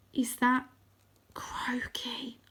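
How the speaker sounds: breathy frightened whisper. Acted. Arthur spotting Croaky, frightened whisper. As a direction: whisper it, scared to be heard. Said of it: "I whisper".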